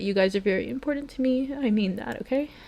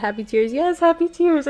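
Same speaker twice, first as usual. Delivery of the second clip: teary, higher and louder; happy tears; 8 semitones higher, 7 dB louder than usual. Real, not acted. Streamer telling chat she cries happy tears. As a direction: reassure chat through tears.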